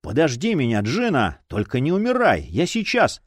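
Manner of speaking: ordinary delivery, nothing marked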